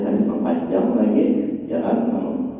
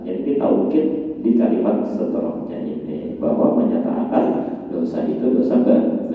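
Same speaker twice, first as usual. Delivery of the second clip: ordinary delivery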